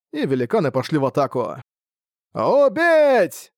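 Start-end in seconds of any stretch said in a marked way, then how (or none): 2.3-3.5 very high, loud drawn-out yell; long rallying shout, pitched way up; battle cry. A narrator's reading. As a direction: take up the war cry at full voice. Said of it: "подхватили они клич старика".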